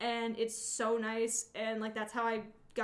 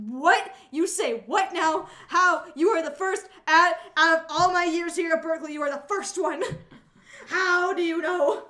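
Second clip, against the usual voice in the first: high, loud, disbelieving squeal; hammed-up amazement, almost shrieking; mock shocked; 6 semitones higher, 13 dB louder than usual. Retelling meeting a Boston classmate, loud astonished squeal. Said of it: "I'm like".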